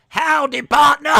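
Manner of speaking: very high, loud cowboy holler in American; American cowboy accent. Shown as a demonstration. Streamer showing viewers an American accent on request, hammed-up cowboy. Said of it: "do your best American accent".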